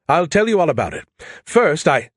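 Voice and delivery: high, excited launch into a story; eager chatter. A narrator's reading. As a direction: burst in brightly, pitched well up. Skippy eager to tell everything.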